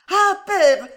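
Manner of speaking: high, loud call to her father; crying out. A narrator's reading. Daughter turning toward the workshop, crying out. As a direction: call out, pitch leaping up. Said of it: "s'écria-t-elle".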